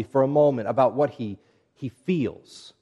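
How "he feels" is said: feels leaned on, drawn out and set apart; stress on feels. Shown as a demonstration. Preacher stressing feels to his congregation. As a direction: put the weight on feels. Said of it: "Emphasis on feels".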